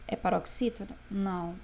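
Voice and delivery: ordinary talk, nothing marked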